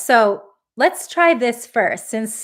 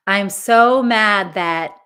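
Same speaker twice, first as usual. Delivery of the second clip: loud, slow, drawn-out venting; voiced anger; 7 dB louder, slower than usual. Shown as a demonstration. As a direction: stretch SO with exasperation. Modelling venting for participants.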